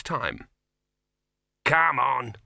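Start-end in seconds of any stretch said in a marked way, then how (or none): 1.6-2.4 loud, sharp "Come on"; snapped. Acted. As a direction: snap at him to get moving. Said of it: "snapped Throat".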